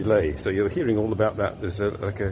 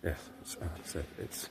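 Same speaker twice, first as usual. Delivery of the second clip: whispering; 4 semitones lower, 16 dB quieter than usual. Real, not acted. Quiet talk in church during practice.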